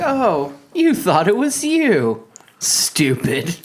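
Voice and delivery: high, drawn-out mock pity; hammed-up condescension, stretching the words in a high voice; super condescending. Woman belittling him for thinking he's special, mock condescending. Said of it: "She was super condescending to him, though".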